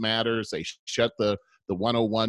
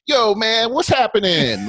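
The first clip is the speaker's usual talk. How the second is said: much higher and louder put-on jive greeting; mock street greeting; 11 semitones higher, 8 dB louder than usual. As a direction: mimic someone talking down to you with slang. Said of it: "Like that".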